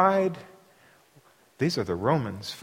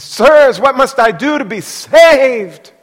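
high, loud pleading cry; desperate jailer; 8 semitones higher, 11 dB louder, slower than usual. Acted. Jailer begs Paul and Silas, loud high plea. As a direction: beg them like a man undone. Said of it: "He says".

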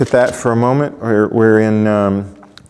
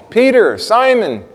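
men at the gate calling for Peter; 8 semitones higher than usual. Retold or imitated. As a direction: shout his name to the house from the gate. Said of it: "and they're calling".

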